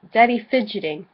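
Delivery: ordinary talk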